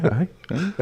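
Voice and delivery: high, soft gasped ayes; mock asthmatic gasping. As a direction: imitate a wheezy local's aye. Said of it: "she was having an asthma attack".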